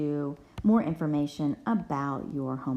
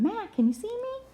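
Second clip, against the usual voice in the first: calling out. Acted. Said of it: "and he's saying".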